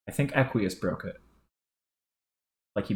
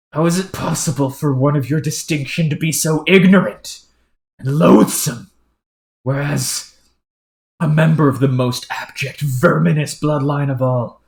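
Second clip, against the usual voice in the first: louder, outraged formal tirade; indignant aristocrat; 4 semitones higher, 9 dB louder than usual. Acted. Noble scolding his crude partner, loud and indignant. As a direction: rail against the ignorance with lofty scorn.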